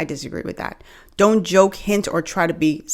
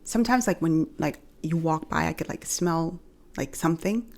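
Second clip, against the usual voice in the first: softer, hushed, hesitant coworker; discreet coworker; 8 dB quieter than usual. Acted. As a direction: lean in and tell her gently on the sly. Coworker discreetly raising body odor.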